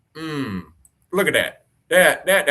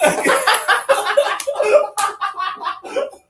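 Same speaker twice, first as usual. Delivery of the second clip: squealing laugh; high laughter fit; 6 dB louder than usual. Real, not acted. Host laughing at his own joke, squealing laughter. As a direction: lose it laughing.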